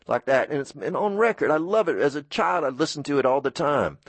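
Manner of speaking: ordinary talk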